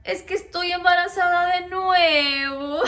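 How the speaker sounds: telling her husband through sobs; crying. Retold or imitated. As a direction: say it through tears. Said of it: "empecé a llorar".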